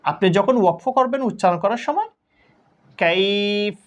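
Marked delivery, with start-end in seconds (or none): 3.0-3.8 কাইফ with the আই drawn out about one alif; stretched vowel. Shown as a demonstration. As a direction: hold the আই in কাইফ long, stop on ফ. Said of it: "এভাবে পড়তে হবে".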